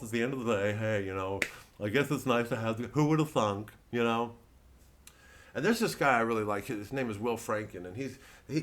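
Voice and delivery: ordinary delivery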